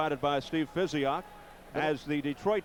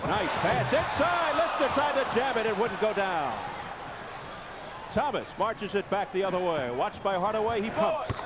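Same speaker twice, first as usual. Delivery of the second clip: excited play-by-play; 4 semitones higher than usual. Acted. Live play-by-play call.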